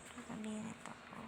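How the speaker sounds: whispered to self. Real, not acted. Gamer muttering to himself at screen, whispered. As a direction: breathe the word out softly. Quiet aside while gaming.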